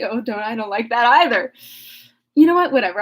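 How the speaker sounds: plain reading voice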